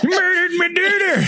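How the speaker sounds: high, wailing accusing cry; dramatic accusation. Acted. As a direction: play a grieving mother accusing. Sudden high far acted line.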